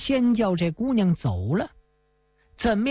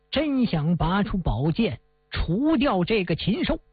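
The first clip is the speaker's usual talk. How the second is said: plain reading voice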